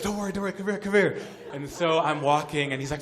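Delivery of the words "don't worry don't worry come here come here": high, loud, eager urging; acted insistent high-pitched summons; imitated calling over. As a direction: beckon someone over excitedly. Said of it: "he calls me over".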